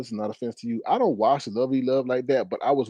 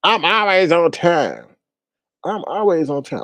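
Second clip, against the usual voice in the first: mocking quote; 7 semitones higher, 7 dB louder, slower than usual. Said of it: "'cause she said".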